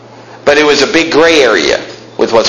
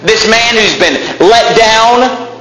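fiery preaching; 9 semitones higher than usual. Acted. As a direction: preach it up to the rafters. Preaching at full force.

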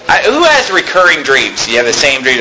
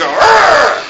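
clenched-teeth growl; 9 semitones higher than usual. Shown as a demonstration. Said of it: "talking through their teeth like this".

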